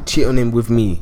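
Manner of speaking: ordinary talk, nothing marked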